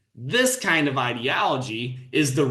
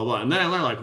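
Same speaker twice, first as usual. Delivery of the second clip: ordinary talk, nothing marked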